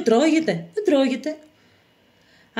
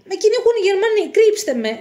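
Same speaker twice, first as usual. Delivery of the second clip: high, urgent begging; desperate appeal for shelter; frightened plea; 8 semitones higher, 3 dB louder than usual. Acted. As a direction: beg like someone being hunted. Hunted youth begs to be hidden.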